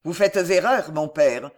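plain reading voice

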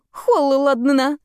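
slow, chattering through the cold; teeth chattering. A narrator's reading. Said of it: "стуча зубами". (стуча зубами от холода)

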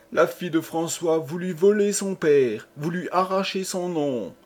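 high, distraught appeal; anguished plea. Acted. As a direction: plead for your child in anguish. Mother pleads for her child.

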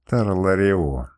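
put-on a disdainful girl sneering at the captain; contempt through teeth. A narrator's reading. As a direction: drag the name out with contempt. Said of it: "снова процедила девушка".

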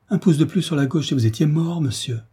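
picture how close he came to death; frightened. A narrator's reading. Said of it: "poussa un cri d'effroi".

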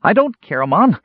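high, loud little creature; kender voice. A narrator's reading. Kender denying it to his warrior friend, high-pitched plea. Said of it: "came the Kender's voice".

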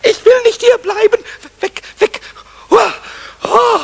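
loud tearful protest; panicked crying. Acted. Goblin trapped in the wastebasket, panicked tearful wail. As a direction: wail it in fear. Stuck in bin, frightened.

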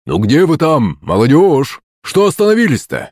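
high, lifted holler to stragglers; calling out. A narrator's reading. Kutuzov calling the lagging youngsters, shouting over. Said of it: "крикнул нам Кутузов".

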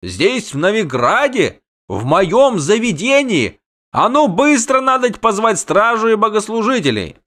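high, alarmed outburst; outraged cry. A narrator's reading. Innkeeper wants guards called at once, outraged. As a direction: raise the alarm, high and scandalised. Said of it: "выкрикнул трактирщик".

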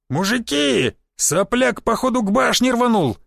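high-pitched alarmed yell to the crowd; roaring shout. A narrator's reading. Someone in the crowd alerts the men, high roaring shout. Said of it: "зарычал кто-то из толпы".

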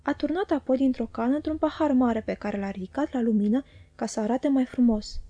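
ordinary delivery, nothing marked

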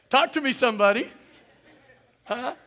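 laughing, high, loud call to the congregation; rally the room for an answer; preacher's call-out. Real, not acted.

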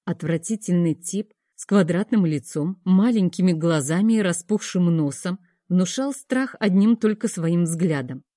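ordinary delivery

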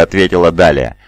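ordinary delivery

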